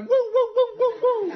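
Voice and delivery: very high whoops; high, hooting American crowd cheer; crowd whooping. Acted. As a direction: imitate an excited audience going woo. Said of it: "And you'd all be going".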